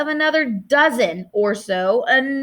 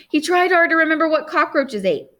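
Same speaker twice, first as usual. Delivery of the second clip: ordinary delivery, nothing marked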